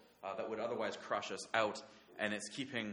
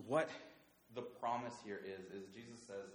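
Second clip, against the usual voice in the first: ordinary talk, nothing marked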